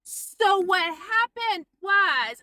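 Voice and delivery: very slow, loud, high mock shout. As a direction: imitate someone over-enunciating to a deaf listener. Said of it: "louder, like I maybe was hard of hearing".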